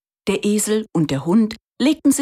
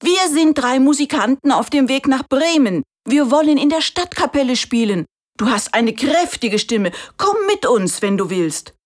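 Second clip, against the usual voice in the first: a higher, louder storybook donkey; friendly donkey; 7 semitones higher, 3 dB louder than usual. A narrator's reading. Donkey asking the rooster to join the band, cheerful invitation. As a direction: invite him along cheerfully.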